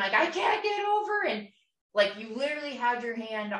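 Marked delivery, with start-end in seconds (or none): 0.1-1.2 very high, shrill-pitched mock scream; retold scream. Yelling back at him from the driver's seat, high mock scream. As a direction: scream it back at the top of your range. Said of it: "and then I'm screaming".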